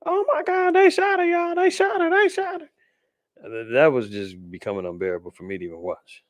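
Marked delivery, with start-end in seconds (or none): 0.0-2.7 very high, panicky imitation of a woman filming; frantic repeating woman. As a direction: squeal it over and over like a shocked witness. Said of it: "just kept repeating herself".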